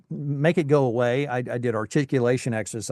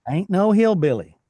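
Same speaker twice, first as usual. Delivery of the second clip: higher, hammed-up Tennessee twang; Southern hillbilly; 5 semitones higher, 4 dB louder than usual. Guest answering host in hammed-up Southern twang. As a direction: lean into the Southern twang for a joke. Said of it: "as Southern as Southern could be".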